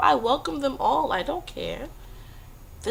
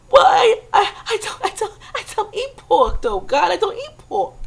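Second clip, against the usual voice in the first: much higher, loud, stammering whine; whining plea; 9 semitones higher, 4 dB louder than usual. Retold or imitated. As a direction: whimper out the excuse to God. Man pleading with God.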